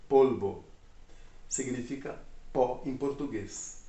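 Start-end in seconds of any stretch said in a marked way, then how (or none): 0.1-0.6 "polvo" as a loud POL-bo, with a clear L; Spanish pronunciation. Shown as a demonstration. Teacher repeats the word for learners, Spanish pronunciation of "polvo" as POL-bo. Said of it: "que a pronúncia muda, né".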